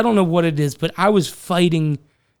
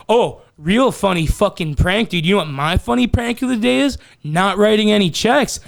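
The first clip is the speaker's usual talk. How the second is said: higher, sneering mock-cheerful taunt; sarcastic jab; 5 semitones higher than usual. Worker taunting his boss after the prank, sarcastic and sneering. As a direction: throw the prank back at the boss with spite. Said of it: "I was acting like that".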